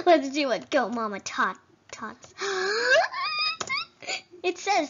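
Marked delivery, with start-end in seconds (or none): 2.4-4.2 long squealing gasp, pitched way up; high excited gasp. Pretend. Player spots something in the game, high gasp. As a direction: gasp in exaggerated delight or shock. Reaction while exploring game.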